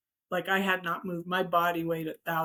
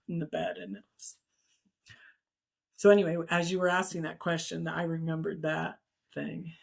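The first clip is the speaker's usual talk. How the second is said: ordinary talk, nothing marked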